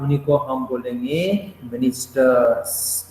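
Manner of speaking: slow, spelled-out answer for the class; slow teacher answer. Shown as a demonstration. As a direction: drag out ministers so the students hear it. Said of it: "उन्हीं को हम क्या बोलेंगे भाई साहब?". (धीमे-धीमे, ज़ोर देकर समझाते हुए)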